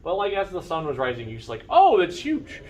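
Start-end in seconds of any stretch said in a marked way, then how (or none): 1.7-2.6 surprised. Pretend. Characters awed at giant tower.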